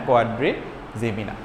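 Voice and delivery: ordinary talk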